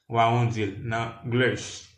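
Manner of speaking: ordinary talk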